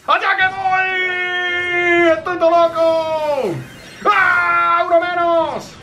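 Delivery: high, drawn-out battle yell; excited shout. Real, not acted. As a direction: yell it as you leap in. Jumping into enemies.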